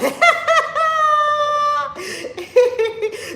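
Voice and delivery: a loud, high burst of laughter. Real, not acted. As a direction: laugh out loud at your own mistake. Laughing at her own slip.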